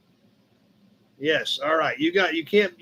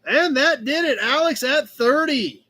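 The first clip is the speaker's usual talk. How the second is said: auctioneer closing call; 7 semitones higher than usual. Real, not acted.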